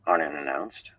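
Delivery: ordinary delivery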